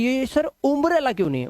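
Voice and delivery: mock pupil's question, saying "oombrella"; questioning student. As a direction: raise the pitch, mimic a puzzled learner. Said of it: "लोग बोलते हैं".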